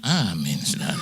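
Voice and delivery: low amen with a falling tune; retold relieved amen. Young listener answers once long reading ends, falling amen. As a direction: answer the pastor's amen, letting it drop. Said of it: "Saya bilang".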